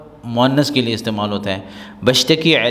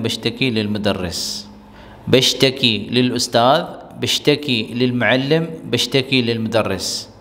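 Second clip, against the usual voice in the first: slow model reading; 3 semitones lower, slower than usual. Shown as a demonstration. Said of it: "teacher models phrases slowly".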